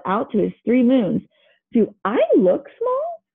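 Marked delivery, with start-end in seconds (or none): 1.7-3.2 high, slow indignant question. Acted. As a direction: demand reassurance, offended. Pluto asks his moons, hurt.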